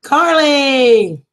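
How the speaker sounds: high, loud happy call. Real, not acted. Greeting a viewer who just joined, with a loud call. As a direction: shout the name across the room.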